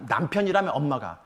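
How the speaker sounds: ordinary delivery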